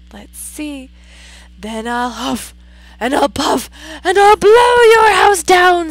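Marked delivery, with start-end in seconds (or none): 1.6-5.9 building to a very loud blow; wolf bellow. Acted. Wolf at the brick house, loud building threat. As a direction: roar the threat. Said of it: "said the wolf".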